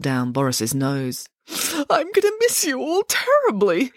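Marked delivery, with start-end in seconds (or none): 1.5-3.9 high, slow tearful bear voice; sobbing. Acted. Bear sobbing goodbye to his family, tearful and high. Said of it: "he sobbed".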